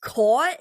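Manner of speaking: "Caught" as "kawt", high, loud and questioning; attempted AW vowel. Shown as a demonstration. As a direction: try out a rounded aw in "Caught", rising. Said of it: "say it".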